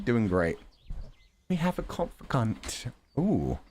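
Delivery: ordinary talk, nothing marked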